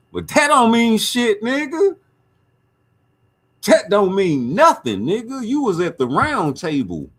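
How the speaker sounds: loud, high call-out; calling him out. Real, not acted. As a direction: jab hard at the guest. Calling out a guest.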